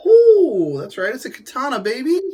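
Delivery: high, bursting excited reveal; excited show-off. Real, not acted. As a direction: show it off with a laugh. Showing off a katana excitedly.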